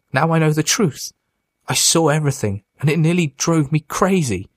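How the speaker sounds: wounded, accusing, voice lifted high; anguished accusation. A narrator's reading. Mitch's anguished accusation of brother.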